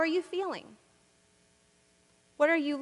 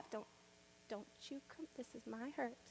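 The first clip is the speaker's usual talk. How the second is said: much softer, possessive hush; hushed warding off; 2 semitones lower, 23 dB quieter, faster than usual. Acted. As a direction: keep them away in a hush. Guarding her hurt from others.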